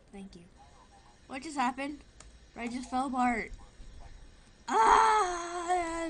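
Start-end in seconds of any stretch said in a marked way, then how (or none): 4.7-6.1 startled cry. Real, not acted. Falls apart in game.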